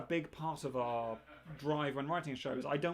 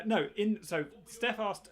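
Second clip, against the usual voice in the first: ordinary delivery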